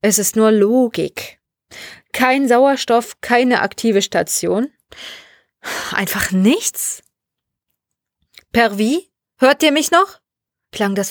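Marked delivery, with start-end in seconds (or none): 0.0-7.0 slow, weighty counter-argument; defensive rebuttal. A narrator's reading. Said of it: "Alexandra hielt abwehrend dagegen". 8.5-10.2 rising, questioning radio call; radio check. Acted. Sergei calls again by radio.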